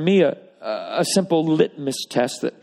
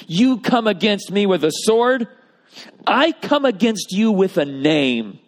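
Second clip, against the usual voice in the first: higher, bold and slow; emphatic declaration; 6 semitones higher, 4 dB louder, slower than usual. Acted. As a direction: proclaim it with confident trust. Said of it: "It's an emphatic declaration of trust".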